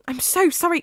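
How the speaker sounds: frantic, pushed-up apology; desperate apology. Acted. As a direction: beg him, voice climbing.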